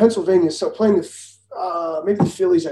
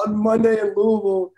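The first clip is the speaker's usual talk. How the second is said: usual speaking voice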